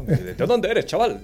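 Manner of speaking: mock drill sergeant yelling at a recruit; movie drill sergeant. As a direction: bark it like a drill instructor. Top Gun quote acted out.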